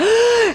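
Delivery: sharp high gasp of hope; excited gasp. Retold or imitated. Hearing the phone ring at last, a sharp hopeful gasp.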